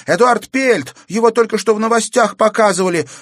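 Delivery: fast, high, loud alarm; girl's cry. A narrator's reading. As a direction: cry out a warning. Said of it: "крикнула Катерина". (встревоженный крик девочки)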